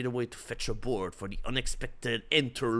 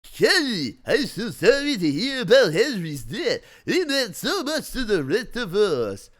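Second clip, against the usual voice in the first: suave stranger voice; 9 semitones higher, 8 dB louder than usual. Acted. Bruce condolences, laughing.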